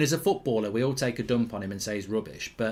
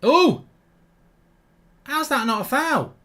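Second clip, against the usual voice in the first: much higher, louder outburst; outraged; 13 semitones higher, 7 dB louder, slower than usual. Real, not acted. Streamer yells at the referee over a missed foul. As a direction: cry out at the referee's call.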